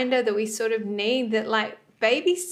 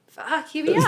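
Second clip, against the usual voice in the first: higher, mock-weary curse; placenta arriving; 6 semitones higher than usual. Placenta just released from the womb, higher mock-weary curse. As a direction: land it like a tired traveller arriving.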